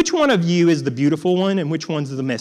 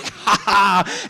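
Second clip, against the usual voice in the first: put-on gleeful little kid's laugh; mock laugh; 3 semitones higher than usual. Boy laughing after hitting his dad's knees, gleeful. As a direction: laugh like a kid pleased with his prank. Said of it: "I was like".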